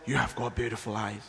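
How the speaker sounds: low, soft, unsettling compliment; creepy possessed voice. Retold or imitated. Possessed man staring into the speaker's eyes, low creepy murmur.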